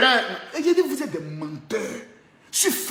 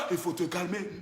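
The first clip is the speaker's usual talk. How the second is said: low, soft sing-song; hushed warning; 7 semitones lower, 11 dB quieter than usual. Acted. Telling the rival to calm down, low and hushed. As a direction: bring it right down, softly.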